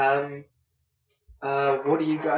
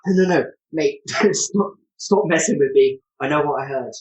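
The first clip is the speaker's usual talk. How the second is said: louder, exasperated denial; stubborn guard telling the player to stop messing; insistent npc; 7 dB louder than usual. Acted. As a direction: brush him off, irritated and loud. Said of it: "He's like".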